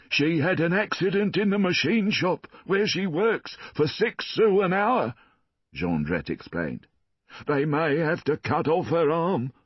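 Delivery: high, whining plea for sympathy; pleading Jondrette. A narrator's reading. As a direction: pile on the misery, pitched high. Jondrette explains daughter's injury.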